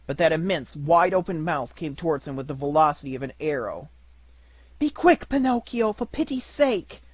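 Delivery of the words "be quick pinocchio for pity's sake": much higher, urgent pleading cry; bleating cry. A narrator's reading. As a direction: bleat it out in panic. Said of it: "cried the little goat, bleating".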